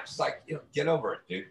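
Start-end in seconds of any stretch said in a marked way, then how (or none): none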